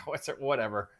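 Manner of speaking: ordinary talk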